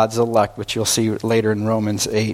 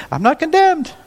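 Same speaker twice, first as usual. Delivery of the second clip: much higher, gleeful cry of a congregant cutting loose; mock carefree sinner. Preacher mocking a congregant who runs off to carouse, high and gleeful. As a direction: chirp it like someone freed to misbehave.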